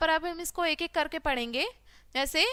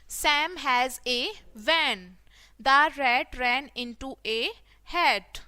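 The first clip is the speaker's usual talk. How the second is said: very slow, separated words; word-by-word reading; much slower than usual. Shown as a demonstration. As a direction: read it out slowly for beginners. Teacher models reading word by word. (धीरे-धीरे, एक-एक शब्द पढ़ना)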